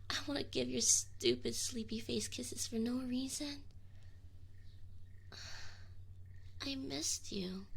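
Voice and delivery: soft, voiceless confession with sighs; tender whisper. Acted. Demon confessing to summoner he missed them, whispering.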